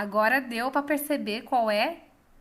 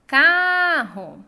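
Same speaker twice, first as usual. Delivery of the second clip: "Carro" called out, CA long, high and loud; calling, stressed CAR; 4 semitones higher, 10 dB louder than usual. Shown as a demonstration. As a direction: stretch the first syllable of "Carro" loudly. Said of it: "Car é a sílaba tônica". (chamando "Carro", com o CA forte e alongado)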